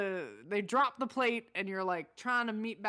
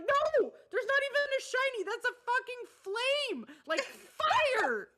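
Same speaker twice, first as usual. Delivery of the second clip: much higher, exasperated outcry; high incredulous yell; 14 semitones higher than usual. Retold or imitated. Gamer exasperated at partner looting by fire. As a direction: cry out at how obvious it is. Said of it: "I'm like".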